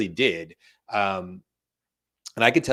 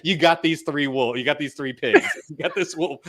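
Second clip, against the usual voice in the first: higher, lightly imitated storyteller voice; amused mock impression, pitched up; Matthew McConaughey; 6 semitones higher, 3 dB louder than usual. Retelling the assistant's Three Pigs, amused light impression. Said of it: "We'll do Matthew McConaughey".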